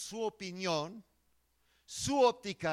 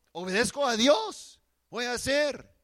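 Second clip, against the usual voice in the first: firm declaration; 7 semitones higher, 11 dB louder than usual. Acted. Ananias' firm declaration of obedience.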